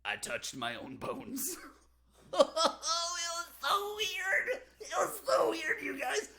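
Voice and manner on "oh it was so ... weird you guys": high, loud laughing squeal; can't get the words out for laughing; laughing disbelief. Real, not acted. Laughing at touching own bones.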